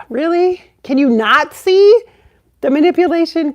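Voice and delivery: loud, high incredulous questioning; worked-up incredulity; exasperated disbelief. Acted. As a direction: demand an answer in disbelief. Exasperated at people being blind.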